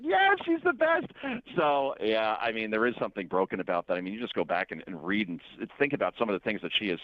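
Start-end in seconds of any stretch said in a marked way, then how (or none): 0.0-1.1 very high, drawn-out mock cheering; mock gushing. Host mimicking the sudden converts, mock gushing. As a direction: gush in a high, slow put-on voice.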